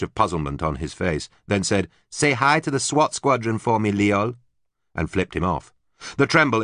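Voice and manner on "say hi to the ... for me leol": high mocking farewell; taunting. A narrator's reading. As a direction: taunt him sweetly. Susie taunting Leol.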